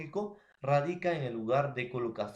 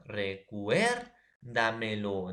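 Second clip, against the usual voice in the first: "Recuérdamelo" lento, con CUÉR marcado; acento en CUÉR; 3 semitones lower, much slower than usual. Shown as a demonstration. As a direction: alarga "Recuérdamelo" y carga la voz en la segunda sílaba, CUÉR. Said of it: "cuer es tras antepenúltima". (énfasis didáctico en CUÉR al decir "Recuérdamelo")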